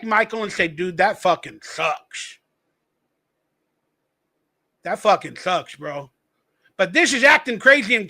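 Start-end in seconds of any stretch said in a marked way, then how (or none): 0.7-6.1 soft, low, slow sympathetic murmur; a hint of pretend empathy, softer; mock sympathy. Host faking pity for the man losing his house, soft. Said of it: "I could be empathetic".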